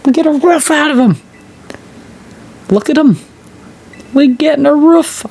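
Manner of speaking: very high squeaky excited yelps; voice cracking with excitement. Real, not acted. Streamer cracks his voice watching the dog, squeaky excited. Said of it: "Sorry, my voice cracked there. I got excited".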